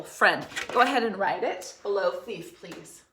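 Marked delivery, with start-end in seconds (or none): none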